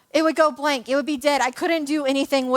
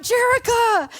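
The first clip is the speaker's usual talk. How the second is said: high-pitched retold call to a friend; 9 semitones higher, slower than usual. Hurt player calls friend way over there, calling out. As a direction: call the name across a room, voice jumping up.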